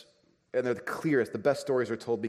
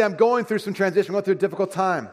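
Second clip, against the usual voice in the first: raised, pleading voice of a friend in transition; struggling friend; 8 semitones higher, 7 dB louder than usual. Retold or imitated. Preacher voices a friend going through transition, raised and pleading. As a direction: lift the pitch and push it out like someone in trouble.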